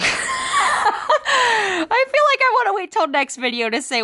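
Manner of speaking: giggling through the words; high, slow, giggly tease; laughing. Real, not acted. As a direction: laugh it out slowly, teasing. Laughing while teasing the news.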